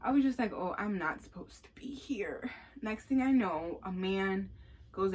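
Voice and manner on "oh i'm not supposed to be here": whisper. Retold or imitated. Awkward aside at the club.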